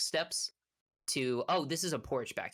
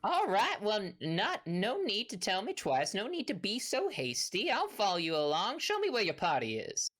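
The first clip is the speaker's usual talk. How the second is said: high, lilting folksy country voice; agreeable half-orc rancher; 6 semitones higher than usual. Acted. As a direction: give in good-naturedly, hammed up. GM plays Big Al agreeing.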